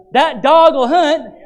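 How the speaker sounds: high, loud, slow Southern churchgoer drawl; Southern call-out. Retold or imitated. Southern churchgoer approving the sermon, slow high Southern call. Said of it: "You go down south".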